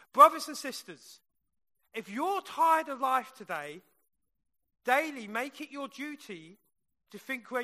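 loud pulpit appeal; preaching. Acted. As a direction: call out to the congregation.

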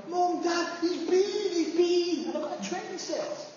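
put-on high, excited little-boy shout; excited child shouting. Small boy shouting to his parents about his train set, excited. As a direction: yell it like a thrilled kid at dawn. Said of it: "the landing, shouting".

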